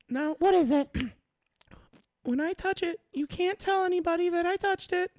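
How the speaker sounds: high granny voice; granny swearing him to secrecy; foul mouthed granny. Acted. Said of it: "my grandma voice is not good".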